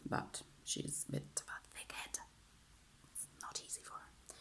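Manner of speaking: hushed, confidential whisper; very quiet whispered confidence; whispered aside. Real, not acted. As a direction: whisper it like a secret aside about someone. Private aside about her mom.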